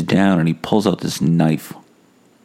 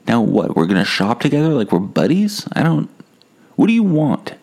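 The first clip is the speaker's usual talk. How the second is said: exasperated pitch lift; 7 semitones higher than usual. Retold or imitated. Arguing with a pushy stranger.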